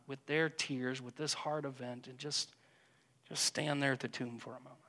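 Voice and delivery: soft, hushed meditation voice, trailing very quiet; gentle contemplative prayer guide; guided meditation. Acted. Leads a silent imaginative prayer.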